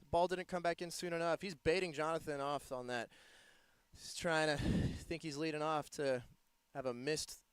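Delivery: normal talking voice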